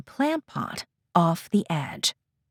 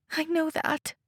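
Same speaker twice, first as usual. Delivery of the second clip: high, soft, tearful break; 10 semitones higher, 6 dB quieter than usual. Acted. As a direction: let the voice crack with tears. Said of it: "her voice breaking as tears begin to well".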